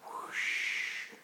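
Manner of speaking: long airy whoosh; a soft rush of air like flight; whooshing bird. Pretend. Parent showing a child the bird, airy whoosh.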